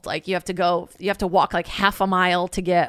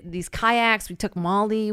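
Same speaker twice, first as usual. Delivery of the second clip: usual speaking voice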